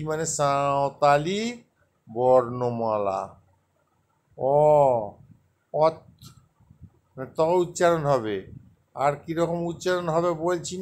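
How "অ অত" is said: "অ, অত" as separate, carefully sounded letters; pronunciation demo. Shown as a demonstration. Teacher repeats Ol Chiki letters for learners, "অ, অত" sounded out separately. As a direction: model "অ" and "অত" one at a time, pausing between. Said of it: "আর একবার উচ্চারণটা বলে দিচ্ছি".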